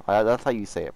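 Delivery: ordinary delivery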